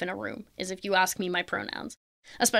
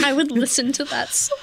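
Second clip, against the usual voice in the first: laughing through words; 4 semitones higher, 3 dB louder than usual. Real, not acted. Joking she'd listen to the song, laughing through words. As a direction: speak while still laughing, pitched up.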